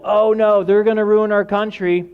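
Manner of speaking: high, loud mock panic; hammed-up alarm; fearful worrier. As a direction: fret like a scared voter. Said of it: "If you become fearful".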